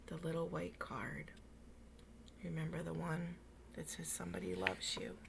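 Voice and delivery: put-on whispered aside to the children. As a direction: breathe it like a secret. Reader aside to listening children.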